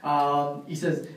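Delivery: usual speaking voice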